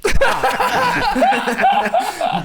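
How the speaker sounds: loud high burst of laughter; big laugh. Real, not acted. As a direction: let out a big cackle. Cackle after tease.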